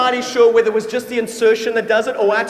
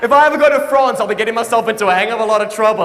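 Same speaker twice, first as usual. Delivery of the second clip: ordinary talk, nothing marked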